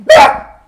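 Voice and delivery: sudden, very loud burst of a shout; jump-scare yell. Pretend. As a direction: yell suddenly to startle the audience. Did I scare anyone.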